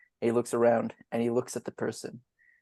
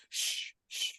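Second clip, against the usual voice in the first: two soft, unvoiced shushes; hushing shush; 11 dB quieter than usual. [name] quieting Vistra, soft shushing. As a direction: hush them quietly, drawn out.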